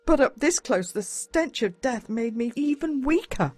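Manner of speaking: plain reading voice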